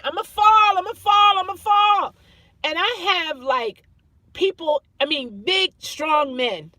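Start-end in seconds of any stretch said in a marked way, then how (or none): 0.0-2.1 high frightened yelling, much louder; retold screaming. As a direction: scream it like you're about to drop. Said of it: "I was screaming and, and yelling".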